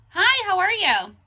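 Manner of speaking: high, loud neighborly call. Real, not acted. Woman asking someone after Jasmine, calling out loudly. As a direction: project a friendly question across a distance.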